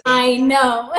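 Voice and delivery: laughing while answering. Real, not acted. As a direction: bursts out laughing. Laughing with host.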